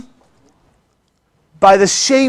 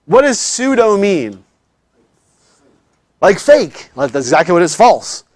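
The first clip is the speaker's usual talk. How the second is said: ordinary delivery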